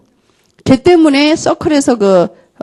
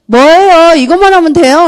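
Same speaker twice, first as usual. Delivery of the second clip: higher, louder student retort; retold incredulous question; 5 semitones higher, 4 dB louder than usual. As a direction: imitate a student taken aback, pitched up. Student questioning the task, acted.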